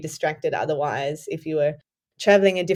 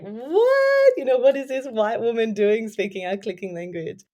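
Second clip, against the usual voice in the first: shocked high "What?"; startled, incredulous local; surprised local; 5 semitones higher than usual. Retold or imitated. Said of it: "it would be like".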